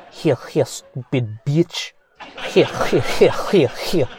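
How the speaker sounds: hammed-up Russian-accented villain cat with a chuckle; terrible Russian accent. Scheming cat to itself, terrible Russian accent. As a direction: do a bad Russian spy accent. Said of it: "That was a terrible Russian accent".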